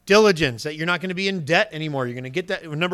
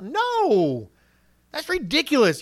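higher, dismissive no; scornful refusal; 6 semitones higher, 3 dB louder, slower than usual. Acted. Preacher voicing a refusal to an old friend, scornful. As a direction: brush the friend off. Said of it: "when somebody asks you to do it".

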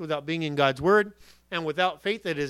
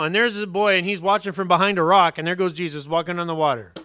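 normal talking voice

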